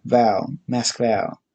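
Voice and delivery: VOWEL said as VAH, then mask-VAH; stress on last syllable. Shown as a demonstration. Explaining the emphasis to viewers, Mask Vowel said as mask-VAH. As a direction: Mask Vowel as Moskva, weight on the end. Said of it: "with the emphasis on the last syllable".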